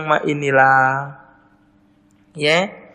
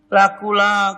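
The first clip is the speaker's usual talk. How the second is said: ordinary delivery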